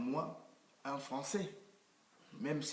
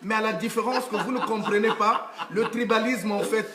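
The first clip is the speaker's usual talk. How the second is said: louder and higher, laughing through the words; proud laughing outburst; 7 semitones higher, 8 dB louder than usual. Real, not acted. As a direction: shout out the confession with a laugh, owning it. Guest proudly claims being tribalist.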